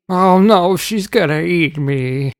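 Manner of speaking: high, slow mock fright; mock panic. A narrator's reading. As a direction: pretend to be terrified. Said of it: "Uncle Shameless cried".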